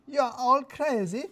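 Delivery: put-on indignant, drawn-out foreign accent; accented Radu. A narrator's reading. Radu refuses the boss, slow accented voice.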